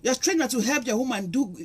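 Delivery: ordinary delivery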